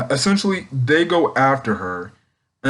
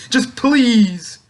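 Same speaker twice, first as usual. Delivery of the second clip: shrill-free high, louder plea as Orochi; high pleading cry; 10 semitones higher, 4 dB louder than usual. Retold or imitated. Villain begging Komurasaki to bow, high pleading cry. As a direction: beg her in a high strained voice.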